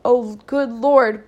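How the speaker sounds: slow, loud startled exclamation; exclaimed. A narrator's reading. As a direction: gasp it out loud as the fire appears. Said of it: "Big Mama exclaimed".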